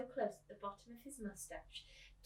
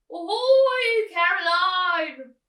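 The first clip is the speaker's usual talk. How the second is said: put-on high, loud, slow hail of a crazy old man; old man calling; 10 semitones higher, 20 dB louder, much slower than usual. Crazy old man calls down to the girl, high and loud. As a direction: hail her from upstairs like an eccentric old man. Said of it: "I love doing the voices".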